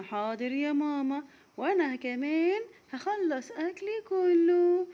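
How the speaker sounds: put-on high, slow sweet child's voice; a small girl promising her mother; little girl voice. Little Raghad answering mama at breakfast, high child voice.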